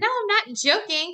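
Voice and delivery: high, giddy no I'm not joking; excited insisting. Retold or imitated. Wife telling husband it's real, high giddy insisting.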